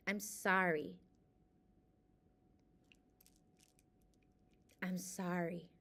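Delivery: quiet heartfelt apology to herself; fading apology. Real, not acted. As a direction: let each sorry fade away. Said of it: "And I'm sorry".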